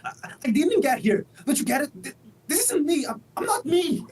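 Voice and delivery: frantic, close to sobbing; panicked. Acted. Interviewee panics about his body.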